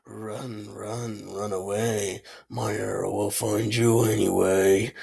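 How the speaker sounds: low, soft villain voice; hushed, deep menacing impersonation; Soul Archer. Acted. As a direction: quote it low and ominous, almost under your breath. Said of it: "Soul Archer. I re-, man, I love this guy".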